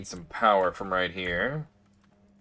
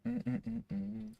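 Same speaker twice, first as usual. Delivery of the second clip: humming; 12 semitones higher, 10 dB quieter than usual. Real, not acted. Hums while waiting for machine.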